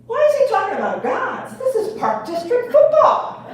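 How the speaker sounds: mock alarm at the coach talking about gods; baffled mom. Mom whispering to husband at practice, mock baffled and high. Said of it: "I said to my husband".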